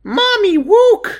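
put-on very high toddler squeal with W for L; little-girl voice. Little daughter calling her mommy to look, high babyish squeal. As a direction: squeal it like an excited small child.

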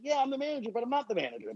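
high, waffling contradiction; evasive manager. Retold or imitated. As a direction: waffle both ways. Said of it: "He's like".

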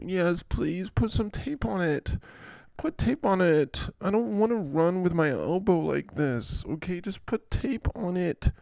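high, whiny injured player; Saquon. Acted. Injured player begging coach for tape, whining.

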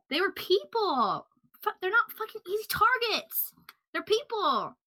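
high, impassioned yell at the killer. Real, not acted. Host defending the murdered women to the listeners, heated yelling. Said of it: "Sorry, I'm yelling".

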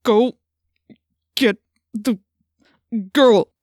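loud, halting, forced-out words; through gritted teeth. A narrator's reading. Chapman fighting his own mouth, through gritted teeth. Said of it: "he said through gritted teeth".

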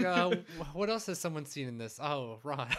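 excited. Retold or imitated. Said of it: "He's like".

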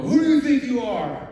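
mock indignant outburst; angry retort. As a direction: snap back at the one rebuking you. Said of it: "raise my temper and go".

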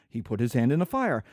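low-pitched, deadpan uncle; matter-of-fact uncle. Acted. Uncle explaining the burn to his brother, low deadpan voice. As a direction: report it low, as if nothing is amiss. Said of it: "And I will say".

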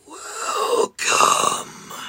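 whispered, cold and eerie; quiet cold whisper. Acted. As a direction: breathe it out icily. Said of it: "said a quiet, cold voice".

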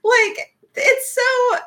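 high squeal of delight, nearly crying; squealing gush. Real, not acted. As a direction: gush like a fan overwhelmed. Gushing about the characters.

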